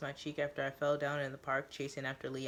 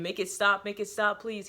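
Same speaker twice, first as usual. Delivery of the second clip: recalled screaming; 5 semitones higher, 5 dB louder than usual. Retold or imitated. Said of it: "after he was screaming".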